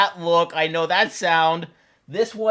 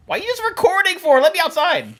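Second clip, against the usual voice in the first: whiny pet voice; 10 semitones higher, faster than usual. Pretend. Voicing cat, pleading.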